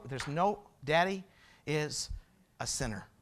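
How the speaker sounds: soft, halting admission, sinking low; subdued confession. Retold or imitated. Father admits his fault to his small daughter, subdued. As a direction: confess quietly, word by word by the end.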